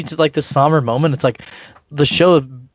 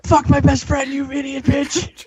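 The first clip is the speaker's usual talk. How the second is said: mocking voice; 11 semitones higher than usual. Mocking quote of character.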